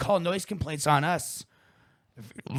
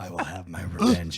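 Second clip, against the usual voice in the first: low, hammed-up villain vow through a laugh; mock villain; 6 semitones lower than usual. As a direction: play a cartoon villain swearing revenge, laughing. Mock villain line while laughing.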